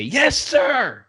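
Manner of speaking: high, shouted military response; unhesitating recruit's reply. Retold or imitated. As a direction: answer the sergeant instantly at a yell. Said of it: "without even hesitating, I say".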